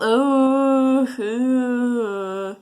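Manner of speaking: drawn-out loud ooh; loud mock ooh. Son teasing dad about the sauce, loud ooh.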